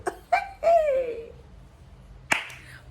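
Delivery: very high gleeful squeal; shrieking laugh. Real, not acted. Celebrating graduation.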